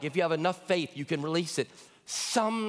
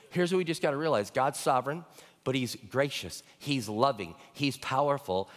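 ordinary talk, nothing marked

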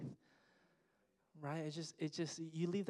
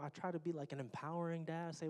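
ordinary talk

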